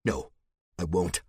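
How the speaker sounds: ordinary delivery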